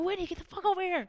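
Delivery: high-pitched mock yell; imitated yelling. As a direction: call out high to a far-off friend, without full volume. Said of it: "me and Juan were yelling".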